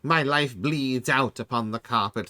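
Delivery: a tiny gnome swooning over his own death; gnomish death voice. Shown as a demonstration. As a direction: overact a gnome's melodramatic death lament. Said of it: "let me get my voice correct here".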